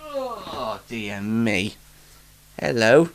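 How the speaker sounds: normal talking voice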